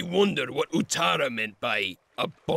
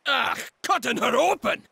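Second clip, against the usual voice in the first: disgusted shock; 8 semitones higher than usual. Acted. Ally hearing Gala may be cut open, appalled and shocked. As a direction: recoil at the horror in your voice. Ally hears of grisly fate.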